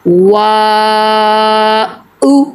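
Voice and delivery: वा held very long, then a separate short उ; long level वा, clean short उ; elongated madd. Shown as a demonstration. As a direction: stretch the aa of वा evenly and end on a brief उ. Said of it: "कैसे पढ़ेंगे".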